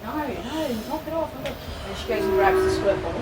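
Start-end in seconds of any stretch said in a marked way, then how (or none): 0.0-1.9 high, mock scolding no no; imitating sister scolding cat. Sister scolding her cat, high mock scold. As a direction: playfully mimic a sister shooing her cat. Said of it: "And then she's like".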